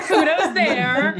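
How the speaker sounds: laughing it out, high and loud. Real, not acted. Praising the kid's spelling while laughing. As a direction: chuckle through the words. Jokey praise of misspelled graffiti.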